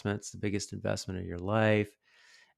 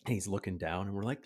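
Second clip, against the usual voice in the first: normal talking voice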